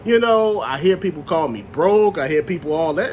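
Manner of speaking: ordinary talk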